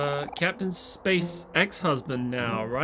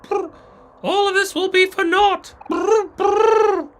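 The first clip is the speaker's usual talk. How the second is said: ghostly shivering voice; 8 dB louder than usual. Acted. Reads a note in character voice.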